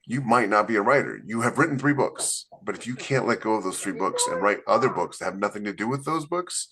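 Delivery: normal talking voice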